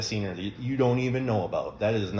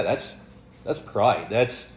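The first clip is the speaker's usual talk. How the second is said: ordinary delivery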